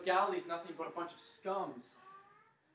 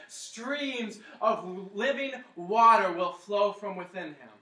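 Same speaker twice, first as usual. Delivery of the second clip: proclaiming; 2 semitones higher, 8 dB louder, slower than usual. Acted. Preacher quoting scripture with force.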